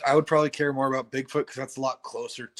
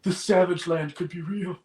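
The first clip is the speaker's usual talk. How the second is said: put-on childlike wonder; 6 semitones higher than usual. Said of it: "standard childlike wonder voice".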